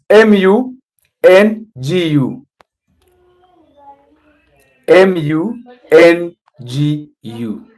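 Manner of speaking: slow, letter-by-letter spelling, each letter held apart. Shown as a demonstration. Teaching the spelling of Mungu to a learner, spelled out slowly.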